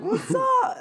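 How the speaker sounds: excited quote. Retold or imitated. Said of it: "I was like".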